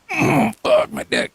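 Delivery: squeaky, slow fake groan of pain; high mock moan. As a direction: whine it way up high, drawn out. Mocking a man in pain.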